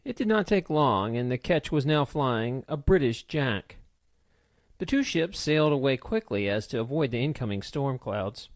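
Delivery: plain reading voice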